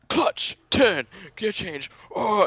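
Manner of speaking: high, loud cartoon-style shout; comic book shout. Imagining the instructor as a comic, high, loud shout. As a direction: yell it like a splash panel in a comic. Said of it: "I imagine that as a comic book".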